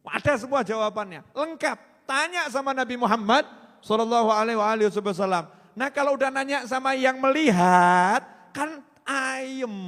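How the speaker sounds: loud, high preaching peak; preacher lifting the crowd; fiery preaching. Acted. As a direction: build the point to a shout for the congregation. Climax on asking the Prophet.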